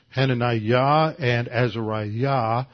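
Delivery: Hananiah and Azariah with the final syllables "-iah" hit and drawn out, a little slow; stress on last syllable. Shown as a demonstration. Preacher to congregation, showing the Hebrew names: stress on the last syllable. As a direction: lean on the end of each name, ha-na-NI-ah, a-za-RI-ah. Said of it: "I'm emphasizing the last syllable".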